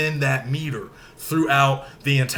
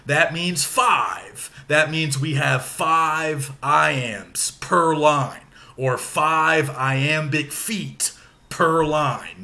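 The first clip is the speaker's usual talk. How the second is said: slow teaching emphasis; much slower than usual. Shown as a demonstration. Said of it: "teacher spells out the rule slowly".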